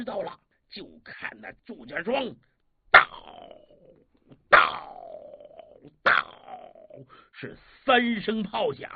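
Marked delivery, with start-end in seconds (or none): 2.9-6.3 three loud booming cannon shots; imitated cannon booms. Storyteller firing the cannons, loud separate booms. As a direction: boom out each cannon blast.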